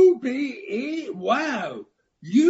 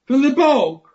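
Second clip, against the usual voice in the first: higher, louder disbelieving echo; incredulous; 4 semitones higher, 3 dB louder than usual. Acted. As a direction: repeat it back in disbelief. Frog mocks the fairy's words.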